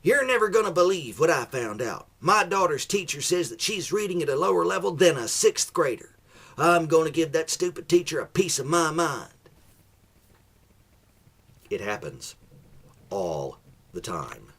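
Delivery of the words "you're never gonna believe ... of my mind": higher, louder indignant rant; outraged parent venting online; ranting Facebook mom. Acted. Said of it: "you'd still hear it in the same voice".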